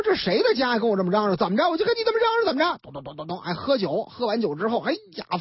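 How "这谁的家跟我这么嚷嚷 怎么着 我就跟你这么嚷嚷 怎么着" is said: higher, half-acted shouting back; a retold mother hollering at her son; storyteller lightly voicing a bickering mother; mock yelling mother.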